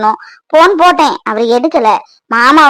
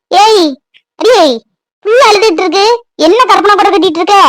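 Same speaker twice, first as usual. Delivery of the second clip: sharp scolding call; 4 semitones higher than usual. Acted. Mother scolding distracted daughter.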